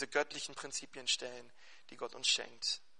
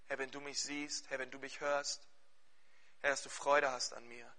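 low, soft, earnest prayer voice; 3 semitones lower, 4 dB quieter than usual. Acted. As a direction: pray quietly and earnestly for the church. Leads the closing prayer.

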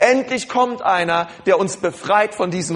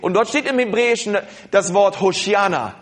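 normal talking voice

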